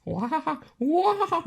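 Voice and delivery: very high squealing cackle. Shown as a demonstration. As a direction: shriek out a silly high-pitched cackle. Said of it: "something like that".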